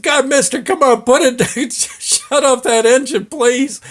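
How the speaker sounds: high, pleading mock whine with a laugh; imitating begging. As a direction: plead like a cornered tough guy, half laughing. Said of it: "They're begging him".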